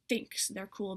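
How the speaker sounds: ordinary talk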